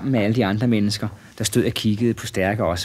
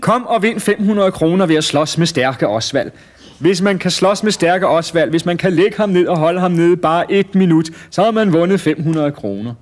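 high, loud barker's pitch; shouted fairground announcement; 7 semitones higher, 4 dB louder than usual. A narrator's reading. Showman with microphone offering prize money, high loud announcing. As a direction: proclaim the challenge to a crowd. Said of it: "og han råbte".

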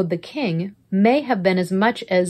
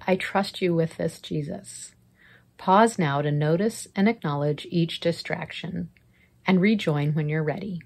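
guided prayer; 3 dB quieter than usual. Acted. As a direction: lead them gently into prayer.

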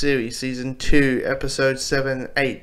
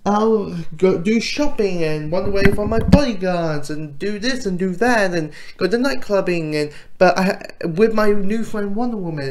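mock carefree princess; 6 semitones higher than usual. Princess who doesn't care about kidnapping, mock carefree. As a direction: lightly imitate a princess gushing over her plans. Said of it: "She's like".

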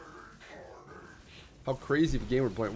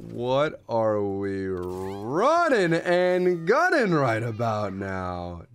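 usual speaking voice

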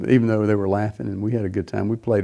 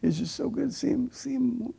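much higher, thin tearful voice; imitating sobbing joy; 6 dB quieter than usual. Nurse overjoyed to see the patient playing, imitated sobbing. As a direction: squeeze it out high through happy tears. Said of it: "she started weeping and sobbing".

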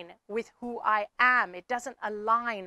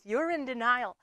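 ordinary talk

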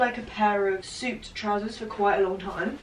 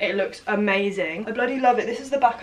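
normal talking voice

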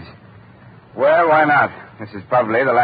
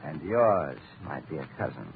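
low and much softer, almost a hush; quiet menace; 9 semitones lower, 10 dB quieter than usual. Acted. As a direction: drop the threat in a low hush. Killer reveals the poisoning.

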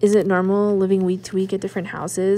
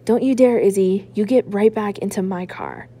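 louder, furious mother hissing the order; angry hiss; 5 dB louder than usual. Acted. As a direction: hiss it at her daughter, sharp and furious. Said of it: "she hisses".

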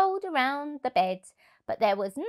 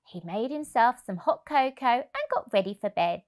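ordinary delivery, nothing marked

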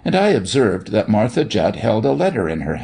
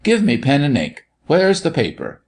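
high-pitched, flustered orders; urgent demand; 6 semitones higher than usual. A narrator's reading. Doctor demanding paper to answer Santa, urgent. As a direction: call for things in a sudden hurry.

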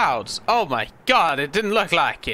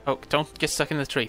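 ordinary delivery

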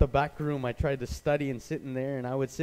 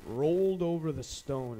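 ordinary talk

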